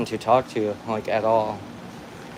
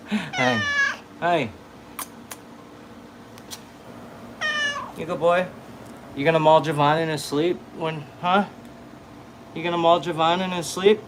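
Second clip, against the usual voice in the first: higher pitched, playful pet voice; 5 semitones higher, 3 dB quieter than usual. Real, not acted. Teasing his cat in baby talk.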